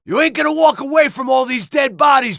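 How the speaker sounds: furious shouting over gunfire; shouting at Becker. Acted. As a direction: bellow it at him, cop to cop.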